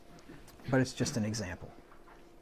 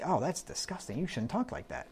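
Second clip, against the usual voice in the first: mock scandalized tutting, softer; prissy disapproval; 3 semitones higher, 7 dB quieter than usual. Preacher mocking the world's prudish complaint, softer and scolding. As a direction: imitate a prudish scold clutching pearls. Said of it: "the world would say".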